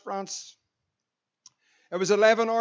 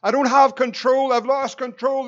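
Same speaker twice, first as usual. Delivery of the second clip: panicked shout; 2 semitones higher, 6 dB louder than usual. Retold or imitated. Co-pilot shouting in the cockpit as control slips, panicked. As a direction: shout it like you're losing the plane. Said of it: "So he shouts".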